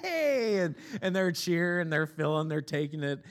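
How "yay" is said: higher, hammed-up cheer on command; bigger mock cheer. Pastor told by his wife to keep cheering, hammed-up cheer. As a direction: push the fake excitement further because she told you to. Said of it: "She's like, "Keep cheering".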